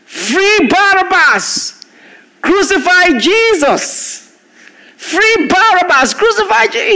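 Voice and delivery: high, slow chanting shout from the mob; crowd screaming. Retold or imitated. Preacher acting out the crowd demanding Barabbas's release, slow high shouted chant. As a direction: howl it like a crowd baying for blood. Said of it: "they screamed".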